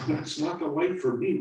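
high, loud, defiant retort. Acted. Little apple retorting to the older one, high and loud. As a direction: snap back with a high, loud voice. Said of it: "retorted the little apple".

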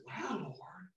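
hushed, breathless wow; awed whisper. Retold or imitated. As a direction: whisper it in awe to the Lord. Awed aside to God.

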